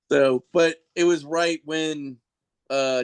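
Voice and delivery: ordinary talk, nothing marked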